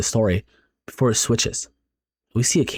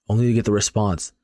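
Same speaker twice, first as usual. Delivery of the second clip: usual speaking voice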